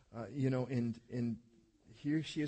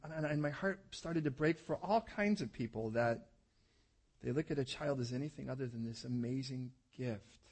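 ordinary delivery

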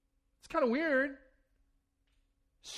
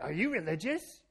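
mock questioning voice; 3 semitones lower than usual. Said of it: "with that kind of voice".

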